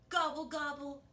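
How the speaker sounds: high put-on gobbling; turkey gobble. Shown as a demonstration. Leading children in gobbling, high turkey voice. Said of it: "Can you gobble, gobble with me".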